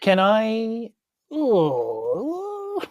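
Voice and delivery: ordinary talk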